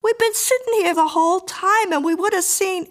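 high, loud, indignant protest; scolding. A narrator's reading. Woman scolds Harry.

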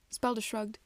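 plain reading voice

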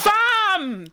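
high-pitched drawn-out cry of amazement; overwhelmed exclamation. Retold or imitated. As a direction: call it out high, as if floored. Retells overwhelmed reaction to praise.

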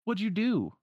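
high, loud accusing question; Sora demanding. Acted. Sora runs at the man after the attack, loud and accusing. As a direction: demand it with pitch leaping up. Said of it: "runs towards him and is like".

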